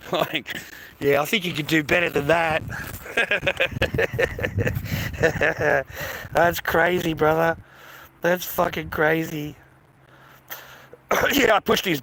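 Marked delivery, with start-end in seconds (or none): 2.7-6.3 long breathy chuckle; drawn-out laugh at his own story. Real, not acted. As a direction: burst into a long, amused laugh.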